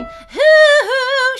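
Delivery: "Hoo, hoo" belted very high and loud; wrong full-on hoo. Shown as a demonstration. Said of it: "she's not going full on there".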